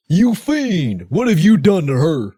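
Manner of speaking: high, loud, hammy hero outrage; the mayor yelling at the kidnappers; outraged Mike Hagar. Acted. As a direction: cry out like a B-movie hero. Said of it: "my Mike Hagar voice".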